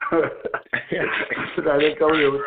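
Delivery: "San Antonio" stretched very slowly, low, through a laugh; drawn-out San Antonio. Shown as a demonstration. Punchline of the Texas-or-Mexico joke, "San Antonio" drawn out very slowly.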